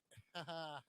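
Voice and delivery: brief, soft chuckle; short laugh. Real, not acted. Amused while viewing bikes.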